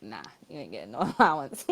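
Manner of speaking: dropping into a low mock-parent brush-off; parents saying no. Imitating her parents turning down an allowance, in a low voice. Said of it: "knew what type of time they was gonna be on".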